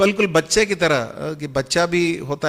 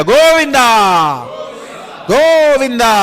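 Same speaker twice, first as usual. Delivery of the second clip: loud, high call of Govinda; devotional cry; 9 semitones higher, 10 dB louder, slower than usual. Acted. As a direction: cry out the Lord's name, long and soaring. Calling out the Lord's name. (ऊँची भक्तिपूर्ण पुकार)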